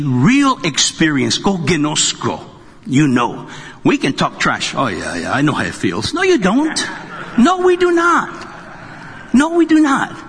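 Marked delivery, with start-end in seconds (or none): 4.7-6.1 low, soft trash-talker's voice; imitated cool, low boasting; mock know-it-all. 6.1-10.2 high-pitched, insistent denial; high, held-out comic contradiction; emphatic mock retort. Emphatic retort to acted boast.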